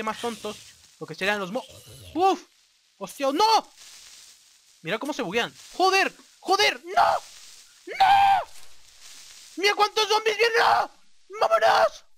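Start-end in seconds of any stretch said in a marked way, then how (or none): 2.1-3.7 startled. Real, not acted. Sudden danger. 5.7-12.0 panicked shouting. Real, not acted. Zombie horde coming.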